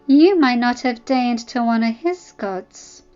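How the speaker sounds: slow, loud sneer; woman sneering back at her rival, slow. Acted. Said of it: "Ella sneered back".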